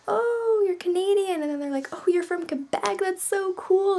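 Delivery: high, loud gushing mock voice; imitating classmates thrilled she's from Quebec; mocking French classmates.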